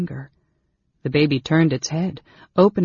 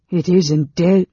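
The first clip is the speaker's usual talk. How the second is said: louder, alarmed dread; fearful; 3 semitones higher, 8 dB louder, slower than usual. A narrator's reading. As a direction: force the fear out loudly. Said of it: "There was fear in his voice".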